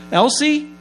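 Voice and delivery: loud, high call to the kitchen. Retold or imitated. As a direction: yell her name across the house. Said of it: "he yelled out to her".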